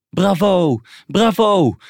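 high, loud cries of acclaim; cheering. A narrator's reading. Audience applauds the actors after the play, loud cheering. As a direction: cheer it out to the performers. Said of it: "juichte iedereen".

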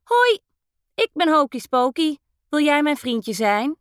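friendly little ghost. Acted. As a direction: play a cheerful tiny ghost saying hello. Friendly little ghost introduces itself.

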